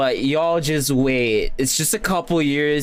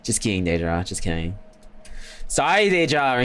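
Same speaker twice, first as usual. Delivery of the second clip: normal talking voice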